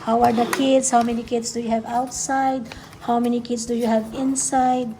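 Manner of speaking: ordinary talk, nothing marked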